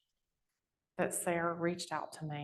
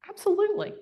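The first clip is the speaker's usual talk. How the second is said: eager yes; 8 dB louder, faster than usual. Retold or imitated. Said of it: "I'm like".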